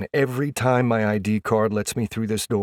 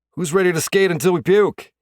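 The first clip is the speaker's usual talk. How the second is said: high, loud, pumped-up team call; rallying teammates; 10 semitones higher, 7 dB louder than usual. A narrator's reading. Player rallies teammates before practice, pumped-up shout. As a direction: fire up the room, pitched up and loud.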